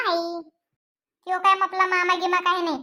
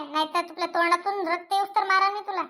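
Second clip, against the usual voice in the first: usual speaking voice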